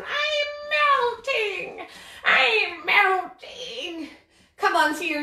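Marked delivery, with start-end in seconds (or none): 0.0-1.9 high, very slow witch wail; melting witch. Shown as a demonstration. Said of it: "slow melt on down like we're the witch". 2.2-4.1 very slow fading wail; melting witch. Shown as a demonstration. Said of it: "slow melt on down like we're the witch".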